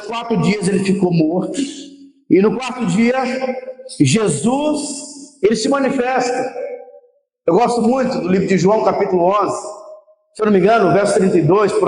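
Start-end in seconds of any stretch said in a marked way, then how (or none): none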